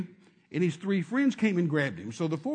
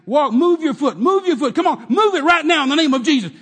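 much higher and loud; shouting command; 9 semitones higher, 13 dB louder than usual. Retold or imitated. Preacher shouting at the man to move his foot. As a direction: shout him into moving. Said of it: "And I say".

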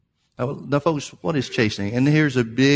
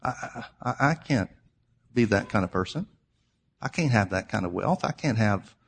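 soft, low, halting voice of doubt; small, hesitant voice trailing into pauses; self-doubting believer; 4 semitones lower, 7 dB quieter than usual. Acted. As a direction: falter quietly, as if unable to believe it. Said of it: "They think".

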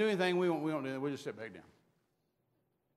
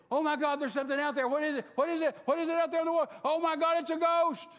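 high, loud panicked yelling; frightened cry; 9 semitones higher, 5 dB louder than usual. Acted. Disciples in the boat, terrified of a ghost. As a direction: cry out in terror at the shape. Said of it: "they're crying out for fear".

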